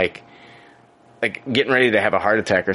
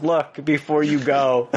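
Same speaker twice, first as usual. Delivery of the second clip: mock PSA warning; 4 semitones higher, slower than usual. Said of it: "public service announcement".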